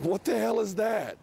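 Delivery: high, drawn-out incredulous reaction; mock disbelief. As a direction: stretch it out high, playing baffled. Acts out reaction to passerby's changed look.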